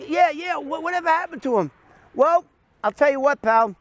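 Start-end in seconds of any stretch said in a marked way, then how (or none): 0.1-1.7 high, fast mock eagerness; eager caller. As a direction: rush the question. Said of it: "She goes".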